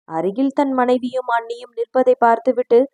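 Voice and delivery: usual speaking voice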